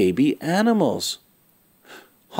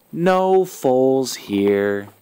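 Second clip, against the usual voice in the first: plain reading voice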